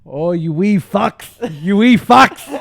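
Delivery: loud, slow mock Irish brogue; Irish accent. Shown as a demonstration. Put on the spot, he fakes a loud Irish accent. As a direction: ham up a thick Irish accent. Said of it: "Give me an Irish accent".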